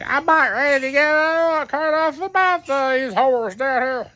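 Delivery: high put-on voice of a drunk buddy. Friend announcing he wants to leave the club, high mock voice. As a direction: squeak it out like your rowdy friend.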